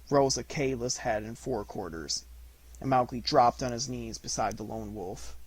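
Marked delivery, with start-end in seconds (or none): none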